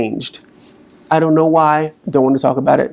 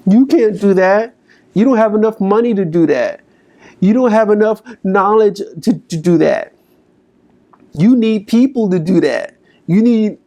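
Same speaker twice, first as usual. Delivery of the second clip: much higher, scornful list of reasons; nagging inner critic; 7 semitones higher than usual. Retold or imitated. Coach acting the negative inner voice, higher and scornful. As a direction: belittle every dream, voice rising. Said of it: "Listen to it".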